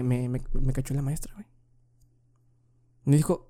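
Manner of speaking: normal talking voice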